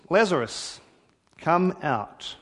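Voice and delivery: calling loudly into the tomb. Retold or imitated. Jesus calling Lazarus out of the tomb, loud command. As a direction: call his name out loud and strong. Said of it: "called out in a loud voice".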